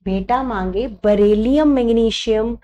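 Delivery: ordinary delivery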